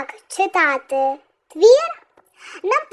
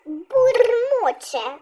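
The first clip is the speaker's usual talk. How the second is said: бурмоче said slowly, МО drawn out; slow, raised бурмоче with a strong О; stress on the О; 4 semitones higher, much slower than usual. Shown as a demonstration. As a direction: stretch and lean on МО in "бурмоче". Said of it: "наголос на О".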